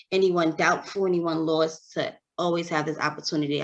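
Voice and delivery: ordinary talk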